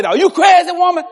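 put-on kid yelling back. Boy yelling back at mama.

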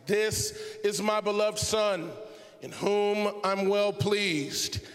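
loud, drawn-out divine proclamation; emphatic voice of God. Acted. A voice from the cloud speaks to the disciples, booming emphatically. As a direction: thunder it out slowly, each word weighty. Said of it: "God was not whispering".

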